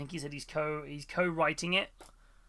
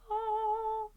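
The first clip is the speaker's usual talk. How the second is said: high amazed oh. Retold or imitated. Mock amazed reaction.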